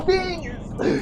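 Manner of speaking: very high, loud echo of a hype cry; mock hype squeal. Echoing partner's hype, high mock squeal. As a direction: squeal it like an over-hyped YouTuber. Echoes the hype shout playfully.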